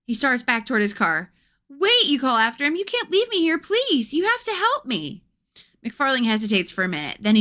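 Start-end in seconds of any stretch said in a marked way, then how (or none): 1.7-5.2 pleading call. Acted. Begging McFarlane not to leave.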